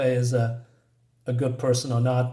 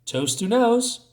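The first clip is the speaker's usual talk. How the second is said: teasing echo; 6 semitones higher, slower than usual. Acted. Lupe echoes Mama's private phrase.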